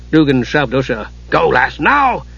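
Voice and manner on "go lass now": urgent command. A narrator's reading. Dugan urgently shoving Usha onward.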